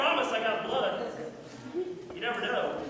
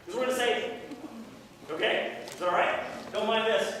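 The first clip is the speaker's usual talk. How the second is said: ordinary talk, nothing marked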